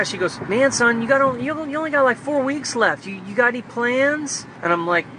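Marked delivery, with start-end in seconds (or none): 0.4-4.4 much higher mom voice, fussing; imitating mom. Son mimics mom questioning his plans, higher pitched. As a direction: put on mom's worried higher pitch.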